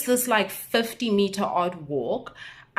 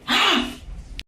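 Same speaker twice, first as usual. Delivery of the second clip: a sharp, breathy gasp of shock; 12 semitones higher than usual. Real, not acted. Vlogger reliving the catcalling, sharp gasp. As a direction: gasp as if it still stings.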